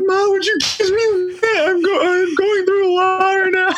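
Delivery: high, strained plea to mom; overwhelmed plea. Retold or imitated. Runner after finishing telling his mom on FaceTime, strained high plea. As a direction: beg for a minute, choked up. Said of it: "And I'm like".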